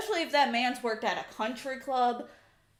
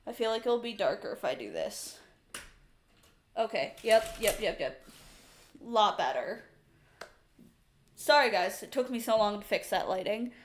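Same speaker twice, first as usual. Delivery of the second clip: usual speaking voice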